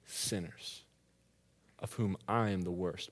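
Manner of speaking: soft, very slow reverent reading; scripture reading. Acted. Preacher reading verse fifteen to the church, soft and slow. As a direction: let each word land slowly and quietly.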